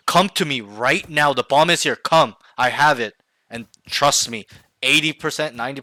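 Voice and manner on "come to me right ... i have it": yelling at teammates. Shown as a demonstration. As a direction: yell it firmly, stretching each word. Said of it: "yell at them".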